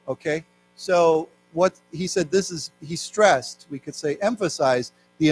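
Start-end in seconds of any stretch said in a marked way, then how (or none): none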